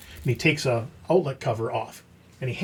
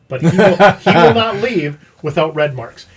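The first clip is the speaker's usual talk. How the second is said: laughing through the words, louder; can't keep a straight face telling it; 10 dB louder than usual. Real, not acted. Laughing as he tells the crew about the inspector. Laughing while retelling the warning.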